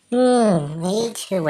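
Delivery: ordinary delivery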